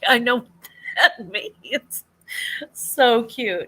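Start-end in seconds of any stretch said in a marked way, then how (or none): 0.1-2.0 laughing retort. Retold or imitated. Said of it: "I'm like".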